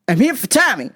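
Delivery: short high-pitched yelled name; mock yelling customer. Customer demanding his order by name, mock yelling. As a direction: yell it like an oddball customer. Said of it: "why are you yelling".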